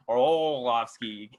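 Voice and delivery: "Arlovsky" very slow, as ar-OHHH-lovsky; drawn-out O in Arlovsky. Shown as a demonstration. Host showing the point of no return, "Arlovsky" with stretched O. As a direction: hold the "oh" in "Arlovsky" far too long. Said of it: "You can't return from that".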